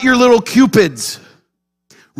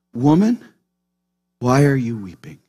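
low, soft, tender question; gentle rebuke; 8 semitones lower, 5 dB quieter than usual. Acted. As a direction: gently question someone who is grieving. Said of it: "this, this gentle rebuke".

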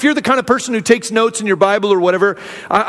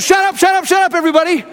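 mock shout; 7 semitones higher, faster than usual. Caleb quieting a million people.

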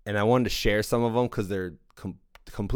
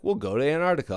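higher, breezy naive voice; lightly mocking carefree planner; mocking the students; 7 semitones higher, 4 dB louder, much faster than usual. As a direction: play the clueless student who shrugs off the danger. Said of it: "you guys were just like".

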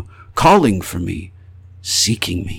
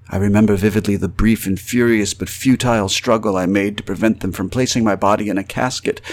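usual speaking voice

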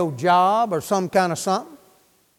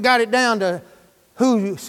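normal talking voice

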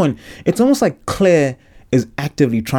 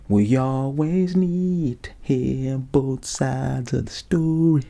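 slow mock-sincere; 2 semitones higher, 4 dB quieter, much slower than usual.

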